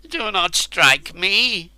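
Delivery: high, slow whimpered plea; whimpering. A narrator's reading. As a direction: whimper in terror. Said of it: "he whimpered".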